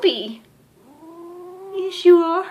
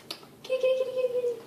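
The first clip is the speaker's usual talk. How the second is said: whispered high kitty kitty call; soft kitty call; 9 semitones higher, 10 dB quieter, much faster than usual. Real, not acted. Owner softly calling her cat back, whispered high.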